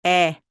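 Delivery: 에 with the mouth wide open and loud. Shown as a demonstration. Teacher demonstrating the big-mouth 에 sound. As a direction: drop the jaw and say 에 big. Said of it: "에 이렇게 큰 소리가 납니다".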